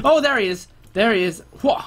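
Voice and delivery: high-pitched burst of excitement; startled, excited gamer call; excited spotting. Real, not acted. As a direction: exclaim as the target suddenly appears. Spots target in game.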